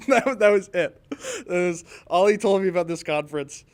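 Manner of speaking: amused, still laughing at the story. Real, not acted. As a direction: laugh your way through the words. Breaks up laughing after the punchline.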